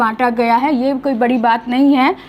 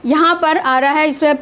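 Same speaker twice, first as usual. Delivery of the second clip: ordinary delivery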